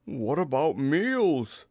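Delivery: hammed-up bear dismay; gloomy. A narrator's reading. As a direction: worry woefully, stretching the words. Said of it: "said Pooh gloomily".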